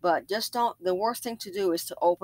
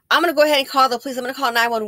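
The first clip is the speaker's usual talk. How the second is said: louder, fast announcement; warning the stranger she'll call 911; firm warning; 6 semitones higher, 9 dB louder, much faster than usual. Retold or imitated. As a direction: threaten to call the police. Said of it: "I said".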